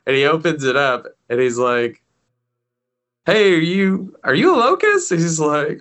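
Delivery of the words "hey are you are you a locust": a gleeful rising question; a hint of a greedy thief; curious question. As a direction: pitch climbing high on locust. Horker asks the bug.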